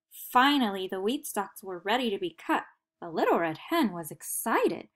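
usual speaking voice

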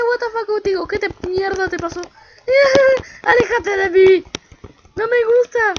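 loud, high frantic screams; terrified yelling. Real, not acted. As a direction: shriek at the spider in disgust. Screaming at spider enemy. (gritos agudos y fuertes de asco y miedo)

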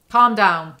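loud exasperated "calm down"; telling Bella off loudly. Real, not acted. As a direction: snap at her to calm down.

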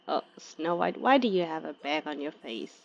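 ordinary talk, nothing marked

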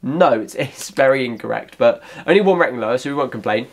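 usual speaking voice

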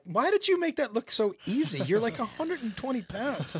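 high, whiny mock disbelief; amused, high-pitched grumbling; incredulous complaint. Acting amazed complaint to trainer.

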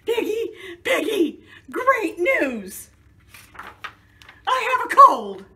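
high, loud, laughing with joy; a gleeful, high, loud announcement; excited news. Acted. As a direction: shout the good news to your friend. Gerald telling Piggy happy news.

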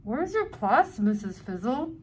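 ordinary delivery, nothing marked

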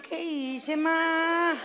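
usual speaking voice

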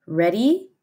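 usual speaking voice

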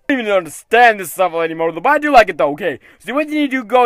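ordinary talk, nothing marked

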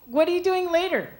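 put-on high, eager girl voice; girly voice. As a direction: ask like a needy girlfriend. Girl texting the guy.

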